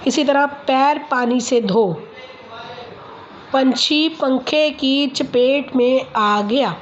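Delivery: very slow, deliberate articulation; slow practice reading. Shown as a demonstration. Speech teacher reads practice sentences to a child, very slow. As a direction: sound out each word for a child.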